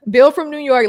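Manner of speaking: put-on amused echo of the accented version; laughing repeat. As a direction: repeat the name through a laugh, with no New York accent. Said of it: "Look, the accent".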